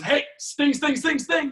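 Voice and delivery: high, loud cartoon cobra voice; mock cobra. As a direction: ham up an attacking cobra, pitched high. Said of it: "cobra turns around and goes".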